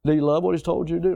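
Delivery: fast, pressing restatement; quick repeated question. Shown as a demonstration. Preacher quickly repeating his challenge to the congregation. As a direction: rattle it off to press the point. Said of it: "repeated rhetorical question".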